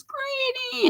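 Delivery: high, soft squealed greeting; playful falsetto call; imitated greeting call. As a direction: mimic a friend's high-pitched hello. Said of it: "Lola J says".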